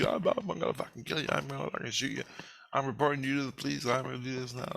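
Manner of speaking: high, quick mock threats without real volume; yelling man. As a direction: imitate a ranting man chasing someone off. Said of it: "he's yelling at me".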